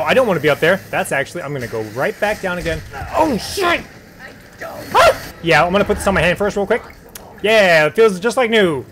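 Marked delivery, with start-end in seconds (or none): 3.1-5.3 startled scream. Real, not acted. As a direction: jump scare shriek. Sudden scare in game.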